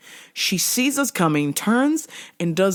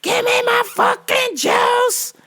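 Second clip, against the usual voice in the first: shrieked threats, much higher and louder; threatening screams; 5 dB louder than usual. Retold or imitated. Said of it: "she goes".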